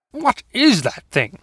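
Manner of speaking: high, drawn-out startled yell; alarmed yell. Acted. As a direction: jump back and cry it out slowly. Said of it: "he yelled".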